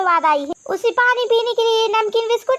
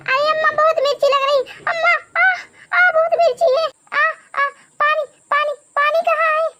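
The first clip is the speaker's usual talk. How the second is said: high-pitched, pained yelping; frantic chilli-burn wailing for water; crying out; 6 semitones higher than usual. Acted. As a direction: gasp out pleas between burning-mouth cries. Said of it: "क्यों चिल्ला रही हो".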